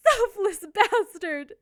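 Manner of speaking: ordinary talk, nothing marked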